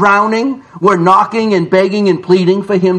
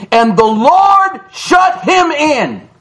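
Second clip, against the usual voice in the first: the Lord's door slams in one slow shout; proclaiming shout; 6 semitones higher, slower than usual. Acted. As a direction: thunder it out like the final word. Preacher proclaims scripture climax.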